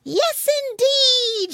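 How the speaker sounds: put-on high, loud, drawn-out child cry; piglets' eager squeal; children shouting. A narrator's reading. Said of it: "shouted the children".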